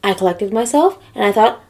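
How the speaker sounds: ordinary delivery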